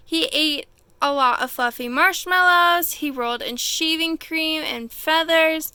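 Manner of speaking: gentle read-aloud storytelling; calm storyteller voice for kids; story narration. A narrator's reading. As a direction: read it like a bedtime picture book. Reads a picture book aloud.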